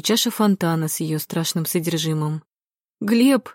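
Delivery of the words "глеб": sharp, raised call of a name; shouting. A narrator's reading. Wife shouts at her sleeping husband, raised cry. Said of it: "закричала Кира".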